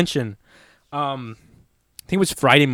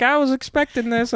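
higher, deadpan mock of the lady; mimicking the woman; 11 semitones higher than usual. Joking that the burned woman expected it, high mock voice. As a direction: say it as if nothing's wrong, high.

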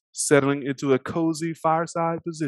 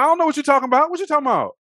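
high, loud protest of innocence; hammed-up bewildered denial; mock denial; 13 semitones higher, 6 dB louder, much faster than usual. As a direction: play dumb in a pitched-up voice. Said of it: "he's like".

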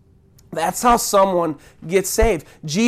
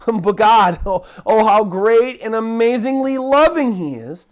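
ordinary delivery

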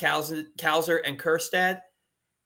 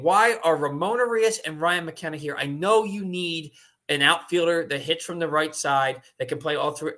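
ordinary delivery

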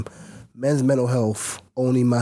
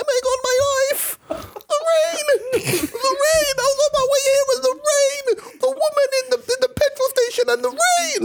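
very high, wailing complaint; hammed-up high-pitched moaning; mock lament. Host moans about the rain on the way, mock lament.

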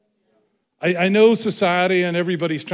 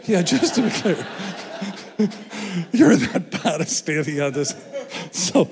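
talking through laughter; higher, giggling teasing delivery; laughing; 4 semitones higher than usual. Real, not acted. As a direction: crack up at your own joke. Laughing at own joke about Ireland.

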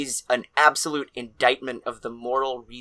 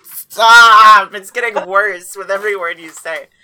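mock pleading shout; 7 semitones higher, 12 dB louder, slower than usual. Real, not acted. Pleading over the silly voice.

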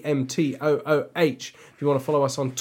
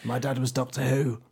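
a mild send-up of Sean Pertwee introducing himself; Sean Pertwee impression; 5 dB louder than usual. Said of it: "he sounds a bit like Sean Pertwee".

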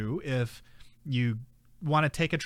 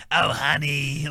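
louder old-lady impression; Grandma Ann; 5 dB louder, slower than usual. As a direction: imitate an old smoker lady calling out. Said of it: "this old lady, Grandma Ann. She was like".

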